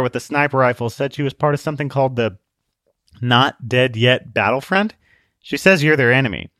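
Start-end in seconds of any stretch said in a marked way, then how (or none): none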